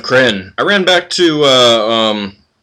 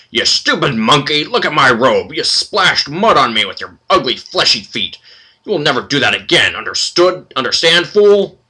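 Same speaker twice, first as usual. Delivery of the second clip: put-on old minotaur yelling, rising to a high furious shout; 5 semitones higher, 4 dB louder than usual. Old minotaur yells at his shackled slave, furious. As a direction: berate the clumsy slave, building to a screech. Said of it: "old, stooped Minotaur yelling at".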